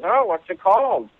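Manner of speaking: a hint of an interested old friend; old man. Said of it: "And the other guy says".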